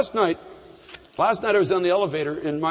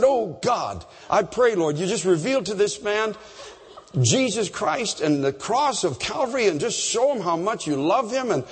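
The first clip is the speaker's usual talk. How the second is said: slow, raised prayer voice, pleading with God; earnest prayer; 4 semitones higher than usual. Acted. Pastor praying aloud over a stranger in a restaurant, slow earnest prayer. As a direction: pray it slowly, lifting each plea upward.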